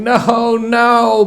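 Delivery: begging not to go, in fear; frightened crying. A narrator's reading.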